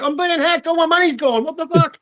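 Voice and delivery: high-pitched mock panic; imitated panicking scammer. Friend acting out scammers who find they are being hacked, mock panic. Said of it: "they're like".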